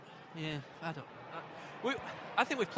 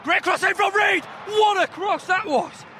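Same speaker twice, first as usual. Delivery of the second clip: loud, high, slowed cheer at a great cross; excited shout; 13 semitones higher, 8 dB louder, slower than usual. Real, not acted. Fan in the stands cheering a cross, loud excited shout. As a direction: yell it out across the stand. Football commentary shout at stadium.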